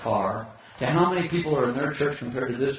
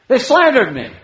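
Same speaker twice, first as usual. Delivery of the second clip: loud, high mock outcry of grievance; hammed-up offended protest; acted indignation; 10 semitones higher, 11 dB louder than usual. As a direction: burst out like someone wronged. Acts out an indignant slandered believer.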